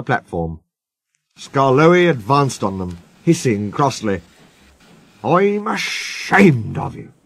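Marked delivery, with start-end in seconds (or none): 5.2-7.1 slow, higher-pitched reproach; cross old engine scolding. Acted. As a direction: tell them off, drawing the words out. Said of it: "he scolded".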